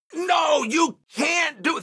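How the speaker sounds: high shouted refusal; retold yelling. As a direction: call out a sharp no from the car behind. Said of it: "They're yelling this".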